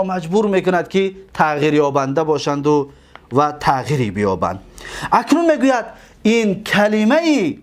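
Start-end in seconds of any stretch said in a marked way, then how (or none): none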